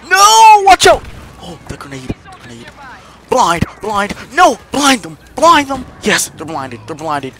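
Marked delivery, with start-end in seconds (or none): 0.1-1.0 very high loud warning; alarmed shout. Real, not acted. Warning teammate. 3.3-5.9 raised urgent commands; urgent shout. Real, not acted.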